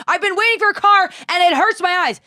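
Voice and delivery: high-pitched aggrieved yell; hammed-up cranky complaint; imitating screaming older woman. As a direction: wail it high, mock-outraged. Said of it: "this woman's screaming at me".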